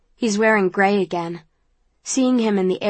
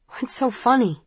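sniffling; 4 semitones higher than usual. A narrator's reading. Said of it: "I say, sniffling".

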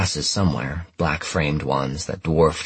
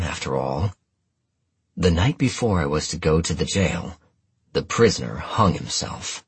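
usual speaking voice

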